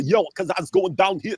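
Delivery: mock dim British street accent; dumb British accent. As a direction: put on a stupid-sounding British accent. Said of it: "British accents that sound real stupid".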